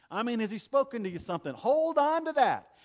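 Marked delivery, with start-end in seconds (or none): 1.5-2.8 preaching shout. Real, not acted. Preacher exhorting mothers.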